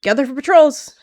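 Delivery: loud, high call to the clan; yell. Acted. Leader yelling to gather the patrols, too loud. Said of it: "she yelled, louder than intended".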